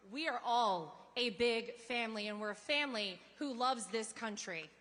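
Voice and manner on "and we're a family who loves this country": ordinary talk, nothing marked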